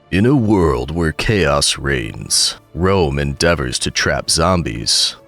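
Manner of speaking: low, slow trailer voice; deep, dramatic movie trailer read; movie trailer announcer. Pretend. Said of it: "that announcer man voice".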